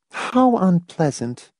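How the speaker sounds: slow, high-pitched, subdued reaction; barely manage to get it out; managed with effort. A narrator's reading. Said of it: "Marcia managed after a while".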